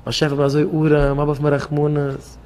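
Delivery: a broken man begging God in a low, soft voice; pleading poor man. Retold or imitated. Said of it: "Stell dich vor".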